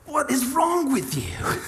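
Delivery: slow, high, incredulous; exasperated disbelief. Retold or imitated. Preacher retelling his reaction to the ungrateful lady, incredulous. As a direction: drag it out in disbelief.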